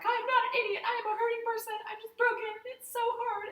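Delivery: very high, loud, hammed-up whine; mock distraught wail. Hurt customer protesting to the pizza guy, mock distraught wail. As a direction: squeal it as an exaggerated emotional breakdown. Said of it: "I'm so angry, I can't even talk".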